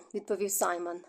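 usual speaking voice

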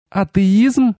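high, outraged female cry; outraged wail. A narrator's reading. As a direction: echo the word in high-pitched disbelief. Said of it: "взвыла Ашарис". (возмущённый высокий возглас)

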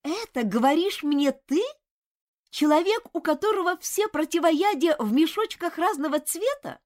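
indignant flare-up at his critic; boiling over. A narrator's reading. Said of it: "вскипел студент".

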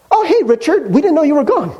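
high, breezy, cheerfully unaware; light mock-casual greeting; casual friends. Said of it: "they're like".

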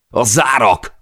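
sudden high, loud alarmed shout. A narrator's reading. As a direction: yell it out over the noise as a warning. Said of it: "kiáltotta Per". (hirtelen, magas, hangos riadt kiáltás)

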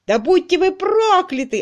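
furious yell. A narrator's reading. Crutchley cursing the clerk in a rage. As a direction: curse them with everything. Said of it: "орал Крачли".

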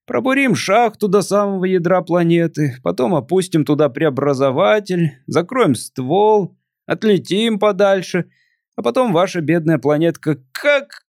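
put-on a melancholy drunk visitor; tipsy alien voice.

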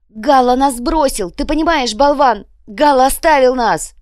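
high, very loud frantic yelling; loud accusing outburst, pitched high; panicked shouting. A narrator's reading. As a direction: shout it like you have been abandoned. Ferdinand keeps yelling in panic.

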